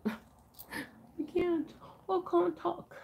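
high, laughing voice; too tickled to talk; laughing helplessly. Real, not acted. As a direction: giggle through the words. Cracks up over own speech.

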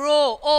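റോ then ഓ, holding the "oh"; isolated long O. Shown as a demonstration. Teacher isolating tomorrow's last sound, long O. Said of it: "Tomorrow എന്ന് പറയുമ്പോൾ".

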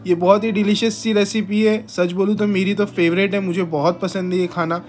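usual speaking voice

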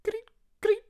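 very high, loud squeaky chirps; cricket chirps. Retold or imitated. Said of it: "The crickets go".

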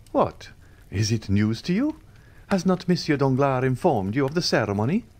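can't believe he hasn't heard; lightly voiced surprise; mild surprise. A narrator's reading. Andrea surprised the Count doesn't know.

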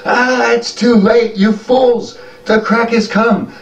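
put-on an old goblin screaming at the fleeing crowd; panicked old goblin. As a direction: warn them frantically in a high old voice. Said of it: "and he goes".

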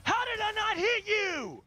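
high-pitched exasperated wail; outraged disbelief. Real, not acted. Player who missed the shot, exasperated yell. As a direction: cry out in disbelief at the miss.

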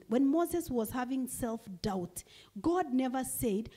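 normal talking voice

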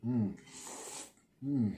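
humming while slurping; slurping noodles. Real, not acted. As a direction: mouth full of noodles. Seen 2: Noodles hang from his mouth, slurping, throughout the labelled hums.

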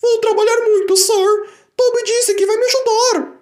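bem aguda e alta, empolgada; Daisy ansiosa. Acted. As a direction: promete com entusiasmo. Daisy promete ao chefe. (Daisy promete ao chefe, aguda e empolgada)

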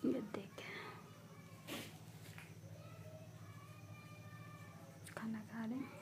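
hushed whisper. Real, not acted. Owner whispering while the kittens eat. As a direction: whisper so the kittens keep eating.

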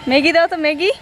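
high-pitched call to the shopkeeper. Real, not acted. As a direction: call across the counter for service. Calling out to the shop seller.

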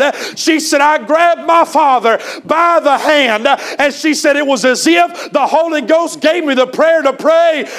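high, loud, shouting sermon delivery; fiery preaching. Acted. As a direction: preach it out at full force to the congregation. Preacher retells story at full force.

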